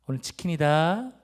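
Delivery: loud, stretched-out announcement; big drawn-out finish; excited father. Retold or imitated. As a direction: imitate dad proudly announcing a treat. Father merrily announces chicken for match.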